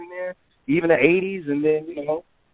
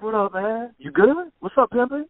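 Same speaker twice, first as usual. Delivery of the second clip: higher-pitched imitation of a slangy greeting; 6 semitones higher than usual. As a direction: greet like a singer strolling in, pitched up.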